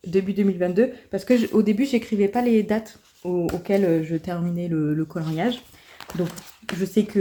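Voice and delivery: normal talking voice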